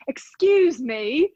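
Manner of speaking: put-on slow, drawn-out British accent; imitated British man's accent. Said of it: "Cue [name]'s accent".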